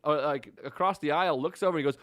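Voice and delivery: ordinary talk, nothing marked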